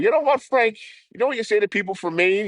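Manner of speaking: high, mocking put-on voice; a high goofy taunt aimed at the local. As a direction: taunt him in a high silly voice. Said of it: "So I'm like".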